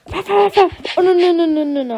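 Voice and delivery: put-on a scared child panicking at the dog; panicked cry.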